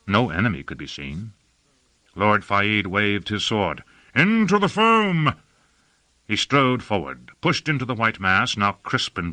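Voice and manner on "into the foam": high, drawn-out rallying cry; rally the troops with a slow, high call; battle cry. Acted. Lord waves his men into the foam, battle cry.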